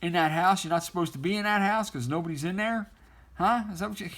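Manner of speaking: high, needling mock voice; mocking taunt. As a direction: tease them for threatening to tell Mom. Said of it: "And he's like".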